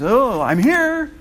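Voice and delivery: high, slow sing-song; mock text. Teacher parodying a vague text message, high and slow. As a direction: drag out a smug little text.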